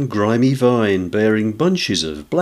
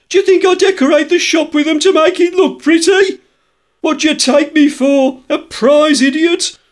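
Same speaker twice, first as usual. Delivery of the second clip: indignant shopkeeper building to a high, loud rant; furious tradesman tirade, climbing ever higher and louder; growing angrier; 6 dB louder than usual. A narrator's reading. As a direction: escalate from affronted questions to shrieking outrage. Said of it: "grow more angry as they proceed".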